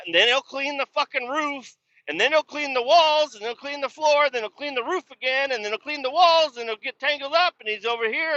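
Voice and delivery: high-pitched, sing-through list of repeated chores; exasperated listing. Real, not acted. Recounting his endless cleaning loop, exasperated listing. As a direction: pile up each step in mounting disbelief.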